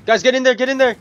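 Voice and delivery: high, loud rallying shout; urgent shout. Real, not acted. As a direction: yell at the team to push. Urging team into fight.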